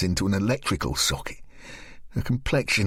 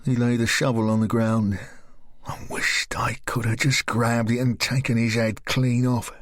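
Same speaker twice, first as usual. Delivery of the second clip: ordinary delivery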